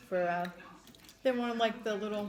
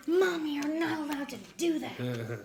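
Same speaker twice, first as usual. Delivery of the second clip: put-on high, drawn-out child's scolding; mock kid yell; 6 semitones higher, slower than usual. Mom retelling her daughter's protest in the store, mock yell. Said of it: "Isabella starts yelling".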